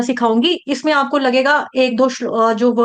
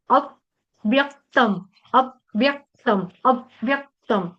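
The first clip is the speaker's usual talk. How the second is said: अव्यक्तम slow, pressure on the doubled व; pressed stress; much slower than usual. Shown as a demonstration. Teacher shows the pressed अव्यक्तम. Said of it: "दबाव डाल के ना, थोड़ा सा जोर लगाकर".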